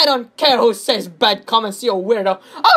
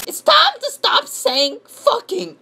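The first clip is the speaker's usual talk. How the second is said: very high put-on squeal; mock scolding commenter; 3 dB louder than usual. Imitating a commenter telling Gabe to stop, squeaky scolding. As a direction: lecture in a silly high voice. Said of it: "you want me to show you?".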